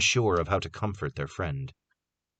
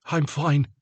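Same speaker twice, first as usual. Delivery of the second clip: insisting; 7 semitones higher than usual. A narrator's reading. Wounded Carter insisting.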